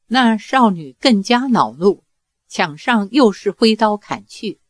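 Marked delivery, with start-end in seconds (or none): none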